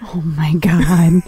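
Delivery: stunned whisper under the breath. Real, not acted. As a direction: breathe it out in disbelief. Shocked reaction, whispered.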